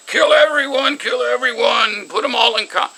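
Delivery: high-pitched mock dictator's scream. Imitating how Hitler's German speeches sound to non-speakers, screaming. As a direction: scream it in imitation of a ranting orator. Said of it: "it sounds like he's screaming".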